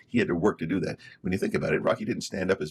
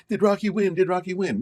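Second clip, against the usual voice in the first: eager crowd asking; 10 semitones higher than usual. Retold or imitated. Audience cheering at movie end.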